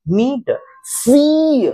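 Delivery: मीट, सी said very slowly with long ee; long ee demo. Shown as a demonstration. Teacher showing long ee, very slowly. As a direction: hold the ee in मीट and सी. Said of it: "अगर हम ई पर रुक रहे हैं".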